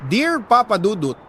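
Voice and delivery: ordinary talk, nothing marked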